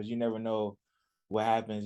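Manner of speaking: normal talking voice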